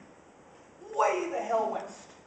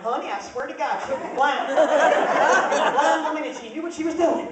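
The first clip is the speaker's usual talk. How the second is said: louder, insistent retelling; indignant husband; 5 semitones higher, 11 dB louder, slower than usual. Retold or imitated. Husband swearing to his wife, louder and insistent. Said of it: "he went home that night and went".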